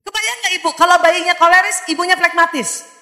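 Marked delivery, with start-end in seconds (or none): none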